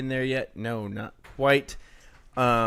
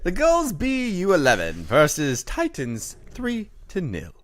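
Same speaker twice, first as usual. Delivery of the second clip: fancy, higher mock-British reading; attempted British accent; 6 semitones higher, 5 dB louder than usual. Host reading a coach's update on request, badly faked British. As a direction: read it loud and fancy in a shaky attempt at British. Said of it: "You're just doing fancy".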